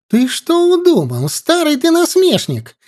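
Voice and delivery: put-on high, shrill-pitched old woman's shout; yelling old woman. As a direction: scold him at the top of her voice. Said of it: "всплеснула руками и завопила".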